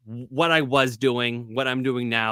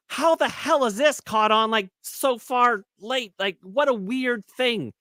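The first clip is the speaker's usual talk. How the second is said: baffled; 9 semitones higher, slower than usual. Retold or imitated. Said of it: "I'm like".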